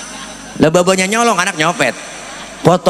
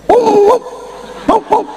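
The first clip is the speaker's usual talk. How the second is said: mock scary hoots. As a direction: hoot at the child to frighten it into sleep. Father scaring baby to sleep. (teriakan "hup" tinggi pura-pura menakuti anak)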